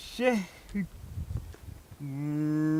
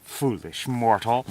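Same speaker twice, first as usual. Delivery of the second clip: booming demon lord; 7 semitones lower, faster than usual. Acted. Wizard mocks a mortal.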